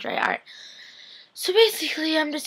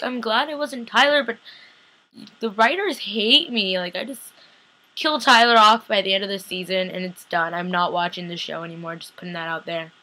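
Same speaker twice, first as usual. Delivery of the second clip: ordinary talk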